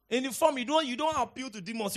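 usual speaking voice

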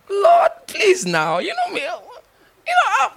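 high, wailing half-laughing plea; comic wailing prayer, high and broken with laughter; mock desperate begging. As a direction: beg the Lord like a whining child, playing it for laughs.

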